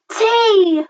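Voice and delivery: very high, startled squeal; gasp, then squeak the word in terror; terrified gasp. A narrator's reading. Little Comp suddenly facing the tyrannosaurs, terrified. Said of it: "she dropped that egg in terror".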